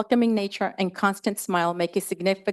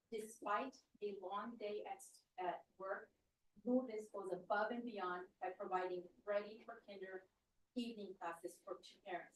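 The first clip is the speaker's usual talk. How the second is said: much softer, voice reduced to a tearful whisper; crying whisper; 21 dB quieter than usual. Real, not acted. Presenter reading Lourdes's tribute, crying in a whisper. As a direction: barely get the words out through tears.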